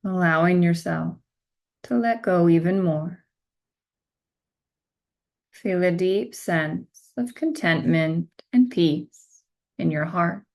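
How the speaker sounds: slow, measured meditation guide voice with long pauses; guided meditation. Acted. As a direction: guide them gently into stillness, one phrase at a time.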